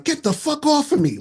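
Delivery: sudden high, loud yell; loud outburst. Retold or imitated. Date yelling at him in a bar, loud and high. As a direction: date snapping at him to get off. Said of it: "like, really loud and shit".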